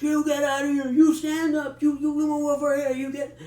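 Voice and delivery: high, loud barked commands; drill sergeant yelling. Retold or imitated. Prison guard barking at a new prisoner, high and loud shouting. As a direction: bark orders like a drill sergeant. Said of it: "starts yelling in a voice that I don't think drill sergeants could have ever".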